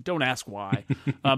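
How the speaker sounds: ordinary delivery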